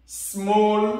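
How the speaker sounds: teacher modelling English; English word demo. Shown as a demonstration. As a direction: model the word "small" for the class. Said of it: "on dit".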